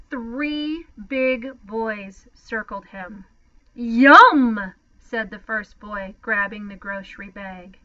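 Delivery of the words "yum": loud greedy yum. A narrator's reading. Bully boy grabs the bag.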